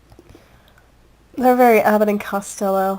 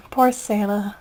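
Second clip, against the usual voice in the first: normal talking voice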